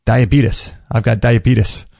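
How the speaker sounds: diabetes said "dye-uh-BEET-us"; imitated mispronunciation. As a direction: end diabetes in "-us", as if spelt with a U. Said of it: "there's a U at the end".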